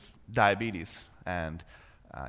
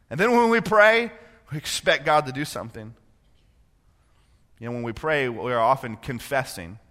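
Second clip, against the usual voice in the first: ordinary talk, nothing marked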